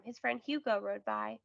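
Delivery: usual speaking voice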